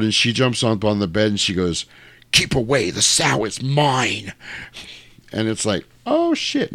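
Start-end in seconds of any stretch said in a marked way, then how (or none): 2.3-4.3 a hint of a slow, creepy demon voice; slow, menacing, lightly voiced possession; creepy different voice. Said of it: "first time she's really spoken in a different voice". 6.1-6.7 shocked. Retold or imitated. Doctors' shocked reaction.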